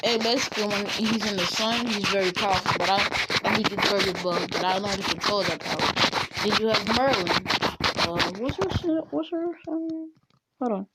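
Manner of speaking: plain reading voice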